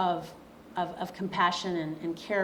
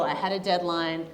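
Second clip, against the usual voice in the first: ordinary delivery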